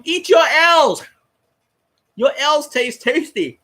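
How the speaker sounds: drawn-out jeering taunt; mocking taunt. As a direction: rub his nose in it, slowly. Taunting Winston's chant back.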